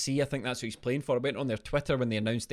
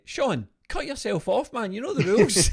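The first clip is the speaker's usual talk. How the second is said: mock scolding call; 9 semitones higher, 6 dB louder than usual. Host loudly scolds Sean to cut himself off, mock stern.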